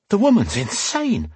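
high-pitched wild disbelief. A narrator's reading. Man fleeing the inn, wild inner exclamation. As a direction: think it aloud, rattled. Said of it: "he thought wildly".

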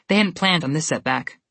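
ordinary delivery

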